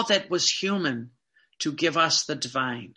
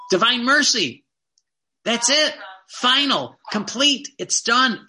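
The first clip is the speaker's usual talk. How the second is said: loud, higher preaching burst; emphatic proclamation; 5 semitones higher, 5 dB louder than usual. Real, not acted. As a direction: drive it home with full force. Preacher proclaims the final devotion.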